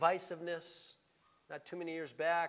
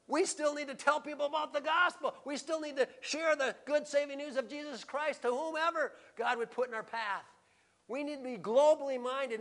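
higher, insistent pulpit voice; urgent preaching; 7 semitones higher, 6 dB louder than usual. Real, not acted. Preacher urging congregation to share the gospel, urgent preaching. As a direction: press the charge on them with force.

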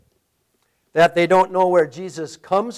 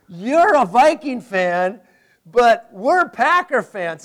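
slow, louder sports-fan jab; mock rivalry taunt; 3 semitones higher, 6 dB louder, slower than usual. Pastor joking about Vikings and Packers, slow taunt. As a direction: draw out the taunt playfully. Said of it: "This is like saying".

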